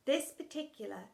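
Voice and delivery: ordinary delivery, nothing marked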